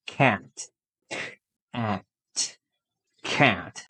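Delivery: "Cat" with the short a of "bad". Shown as a demonstration. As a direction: model the short vowel. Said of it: "Quantos sons você está ouvindo". ("cat" com a curto)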